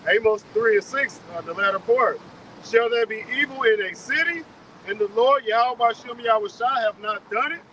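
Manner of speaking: slow, loud, formal reading of the verse; scripture reading. Acted. Reading a verse from Amos to the group, slow loud recitation. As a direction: declaim it like a pulpit reading.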